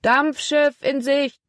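high, drawn-out lookout's call; man calling out. A narrator's reading. Man on shore announcing the steamboat, calling out. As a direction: call it slowly across the water, pitched high. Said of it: "erklang die Stimme eines Mannes".

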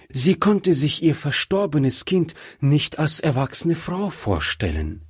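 usual speaking voice

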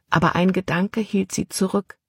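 ordinary delivery

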